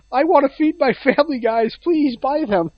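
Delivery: high-pitched, pitiful sales plea; pleading inventor. Acted. Poor guy who created the Kinect begs buyers, pleading. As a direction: beg like a desperate family man. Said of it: "poor guy who created the Kinect's like".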